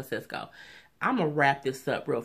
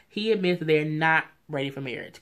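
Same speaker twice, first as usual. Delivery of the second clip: usual speaking voice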